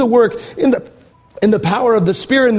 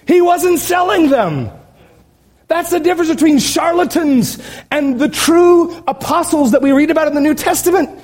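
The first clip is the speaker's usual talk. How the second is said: high, emphatic preaching; fired up; 6 semitones higher than usual. Real, not acted. Preacher separating charlatans from true apostles, fired up. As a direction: drive the point home in a high, fired-up voice. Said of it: "Sorry, I'm fired up".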